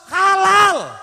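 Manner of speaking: loud, high shout of halal; shouted verdict. Retold or imitated. Preacher shouts the kiai's ruling, loud and high. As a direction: bellow it like a final ruling.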